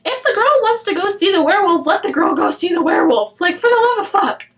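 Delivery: high, loud exasperated rant; exasperated outburst. Real, not acted. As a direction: throw up your hands and yell it. Exasperated rant about the book.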